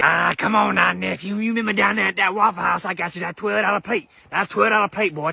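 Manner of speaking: lightly voiced uncle, wheedling; a hint of a scheming relative calling in a debt; greedy uncle. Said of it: "Quick to throw up shit that they did for you".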